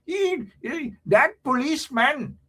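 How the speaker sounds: high-pitched mock Indian accent scolding. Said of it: "No, that's Indian voice".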